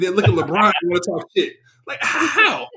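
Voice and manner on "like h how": high-pitched, stammered disbelief; real bafflement, pitch leaping up; incredulous. Real, not acted. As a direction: stumble into an astonished how. Incredulous at fans heckling LeBron.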